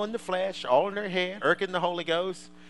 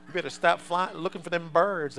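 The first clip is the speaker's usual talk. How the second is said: ordinary talk, nothing marked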